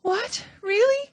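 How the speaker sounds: very high, rising astonished squeal; a piglet hearing he may be saved; surprised cry. A narrator's reading. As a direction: gasp it out in hope. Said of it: "cried Wilbur".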